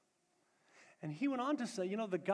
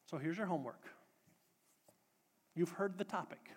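ordinary talk

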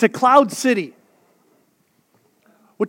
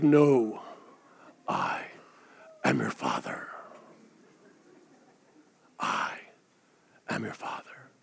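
deep, low breathy menace, much softer; Darth Vader whisper; 13 dB quieter than usual. Retold or imitated. Preacher quoting Star Wars to the church, in a low Vader rasp. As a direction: rasp it out like Vader behind the mask. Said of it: "Darth Vader says this. He goes".

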